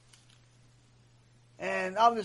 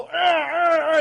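loud, raised "arr" growls; hammed-up monster snarling; imitated growl; 8 semitones higher, 9 dB louder, slower than usual. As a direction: roar it out in bursts. Imitated growl of punishing beings.

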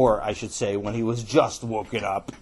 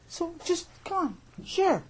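very high, squeaky plea; pleading; 4 dB quieter than usual. Acted. Boy begging his brother to share, high-voiced pleading. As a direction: coax in a tiny high voice.

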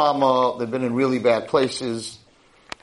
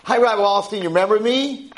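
put-on eager girl; 8 semitones higher, faster than usual. Girls greeting rabbi eagerly.

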